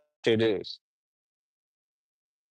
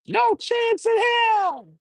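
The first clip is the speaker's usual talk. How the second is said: high, slow shouted "no chance in hell"; wrestler catchphrase; much slower than usual. Retold or imitated. Streamer imitating a wrestler's catchphrase, high and slow shout. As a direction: shout it slow like the heel boss. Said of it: "He be like".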